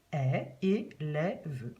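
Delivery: "est, et, lève" slowly, sound by sound; careful teacher sounding out of é and è; word sounded out. Shown as a demonstration. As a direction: break "élève" into its separate sounds. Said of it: "mon accent grave pour est".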